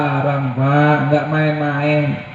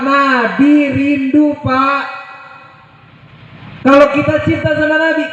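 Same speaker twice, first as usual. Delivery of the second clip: high-pitched, fervent sermon delivery; impassioned preaching; 9 semitones higher than usual. Acted. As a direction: preach it high, stretching out key words. Preaches with rising fervour. (khotbah berapi-api bernada tinggi)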